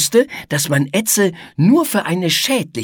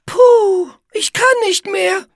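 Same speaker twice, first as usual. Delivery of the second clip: very high, tiny spider voice, worn out; squeaky little spider calling that she's spent; fine, exhausted sigh; 4 dB louder than usual. A narrator's reading. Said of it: "rief Igitte endlich mit einem spinnefeinen Seufzer".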